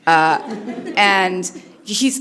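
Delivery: ordinary delivery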